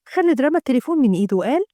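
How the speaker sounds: plain reading voice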